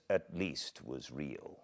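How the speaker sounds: plain reading voice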